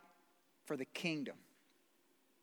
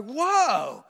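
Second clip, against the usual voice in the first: mock awe; 3 semitones higher, 10 dB louder than usual. Mock awe at Hollywood marriage.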